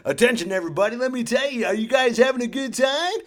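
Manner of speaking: loud, high, peppy PA voice; rink announcer. Retold or imitated. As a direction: hype up the crowd over the speaker. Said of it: "on the speaker".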